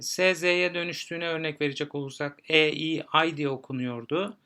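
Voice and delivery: ordinary delivery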